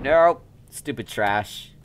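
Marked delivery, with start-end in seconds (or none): 0.0-0.4 high loud nope; loud startled nope; yelped nope. Real, not acted. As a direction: yelp it as you bail. Yelped refusal at danger.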